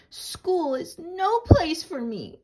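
put-on high, slow whiny girl voice. Chrysanthemum complaining to her parents, high and whiny. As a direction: whine it high and slow like a hurt little girl.

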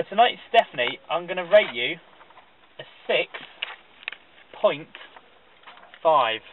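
"six... point... five" with long dramatic pauses; suspenseful score reveal. Real, not acted. Guest announcing Stephanie's score, "six... point... five" drawn out in suspense. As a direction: hold back each part: "six", "point", "five".

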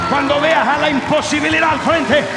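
high, loud fervent sermon cry; full-force preaching. Acted. Pastor urging the church through impossibilities, loud fervent preaching. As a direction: preach it hard to the congregation.

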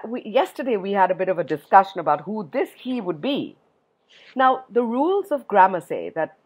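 usual speaking voice

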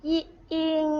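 อิ then อิง, high and level; sounding out a syllable. Shown as a demonstration. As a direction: model อิ อิง slowly, each held on one high note. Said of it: "วิธีการอ่าน".

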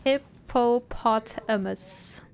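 slow, syllable by syllable. Shown as a demonstration. Sounding it out slowly for chat. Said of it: "pronouncing the syllabus slowly".

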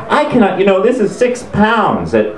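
high, worked-up imitated host; mock indignation. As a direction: protest with hammed-up outrage. Mimicked host's emotional outburst.

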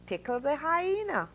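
high, calm voice from above; the Lord. A narrator's reading. The Lord answering Noah's prayer, high and calm. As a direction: speak as God giving a strange order. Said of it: "the Lord said again".

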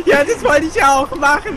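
talking through loud laughter; laughing. Real, not acted. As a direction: laugh while you explain. Laughing while answering.

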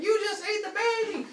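high, loud horrified wife; hammed-up hysterical scream; freaking out. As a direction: shriek the accusation in a high voice. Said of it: "Rhea freaks out".

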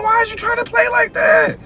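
exasperated shout. Real, not acted. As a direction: yell at her to quit playing. Girlfriend alarmed by fake burn.

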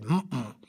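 short closed-mouth "mm-mm"; greedy frog refusing with lips shut; closed-mouth refusal. Acted. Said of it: "that big greedy diddly".

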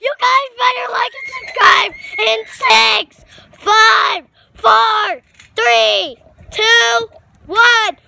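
loud shouted countdown; shouting. Real, not acted. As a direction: call it out to everyone.

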